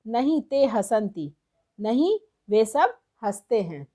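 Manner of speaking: normal talking voice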